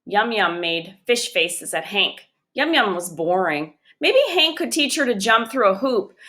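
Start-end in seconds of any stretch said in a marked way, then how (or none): none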